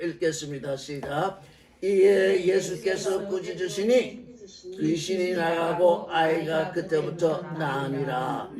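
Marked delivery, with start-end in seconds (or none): 1.8-8.5 scripture reading. Acted.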